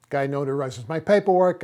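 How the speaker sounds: normal talking voice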